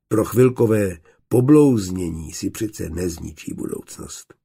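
ordinary delivery

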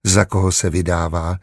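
usual speaking voice

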